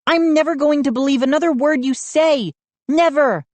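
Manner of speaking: fast, high indignant vow; Mindy swears off believing Joe, indignant. A narrator's reading. Fast high resentful vow. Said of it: "Mindy angry at the boys".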